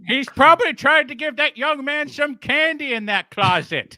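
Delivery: high-pitched scolding granny voice; shouting grandma impression. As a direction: scold the child as a cranky grandma. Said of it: "started shouting at me".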